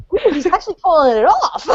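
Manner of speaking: mock-impressed marvelling; acted-out awed "ooh"; impressed reaction. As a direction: marvel as if pleasantly surprised. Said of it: "they go right in front".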